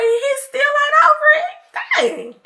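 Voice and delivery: high-pitched mock disbelief; incredulous exclamation. Customer returns to find him still cursing, incredulous. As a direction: shoot way up high in exasperated amazement.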